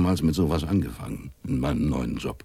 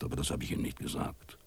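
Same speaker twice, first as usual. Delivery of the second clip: quiet aside; 5 semitones lower, 12 dB quieter, faster than usual. A narrator's reading. Narrator's hushed aside.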